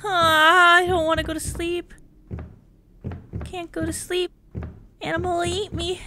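put-on tearful, childlike fretting; scared whimper. Player afraid to let the character sleep, scared whimper.

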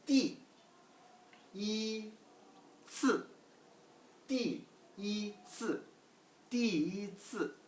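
띠, 이, 츠 high, one at a time, 띠 and 츠 falling, 이 held level; slow, syllable by syllable. Shown as a demonstration. Teacher sounds out a new word, slow syllable by syllable. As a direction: separate 띠, 이, 츠 slowly with clear tones. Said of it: "천천히 한번 하나 하나씩".

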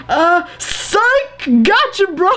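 high-pitched, hammed-up cover-up; mock gotcha in a high voice; awkward joking retraction. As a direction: play off the confession as a prank. Acted bro retracting a confession.

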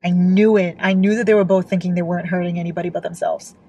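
usual speaking voice

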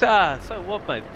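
high taunting retold bloke; cocky Mini bloke. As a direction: needle him from up high. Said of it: "He goes".

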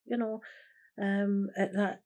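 ordinary talk, nothing marked

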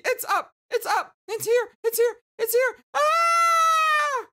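very high squeals building to a scream; frantic excitement. Acted. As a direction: shriek in bursts, end on a long loud scream. Character frantic that mail arrived.

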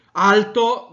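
loud. Shown as a demonstration. Teacher demos loud volume to the class, loud. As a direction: belt it out to show loud volume. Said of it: "il paralinguaggio è dato dal volume".

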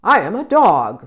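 loud, low barking storybook dog; woofing dog, low and forceful. Acted. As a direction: bark the words like a big dog. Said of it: "said the dog".

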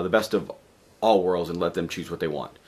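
ordinary talk

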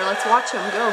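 ordinary talk, nothing marked

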